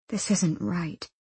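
slow, soft, dejected; hushed, heavy inner voice; miserable thought. A narrator's reading. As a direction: think it aloud quietly in misery. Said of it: "Nina thought miserably".

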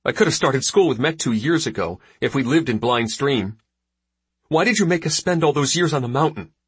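upset and reproachful, pitched high; hurt accusation. Acted. Tearful son accuses his father.

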